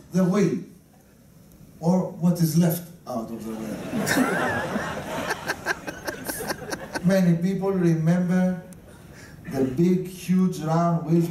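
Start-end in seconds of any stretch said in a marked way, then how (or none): 3.8-7.0 breathy laugh. Real, not acted. Laughing at own joke.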